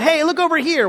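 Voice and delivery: high-pitched distracting call; misdirecting magician. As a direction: draw their eyes away, pitch jumping up. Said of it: "They want to misdirect".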